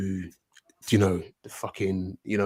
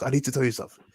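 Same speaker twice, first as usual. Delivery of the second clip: imitated Nigerian mum, slightly raised pitch; Nigerian accent; 5 semitones higher, much faster than usual. Guest imitating his mum with news, Nigerian accent. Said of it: "in a Nigerian accent".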